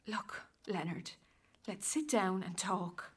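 soft, slow whisper; hushed plea. Acted. Wife trying to calm her husband after the fight, whispering. Calming him after the row.

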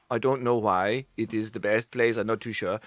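a modest Finn unsure why; Finnish shrug. Retold or imitated. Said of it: "they will go".

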